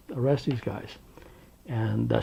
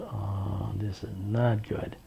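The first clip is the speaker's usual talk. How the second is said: muttered to himself; 3 semitones lower, 7 dB quieter, much slower than usual. Retold or imitated. Inner thought while being beaten.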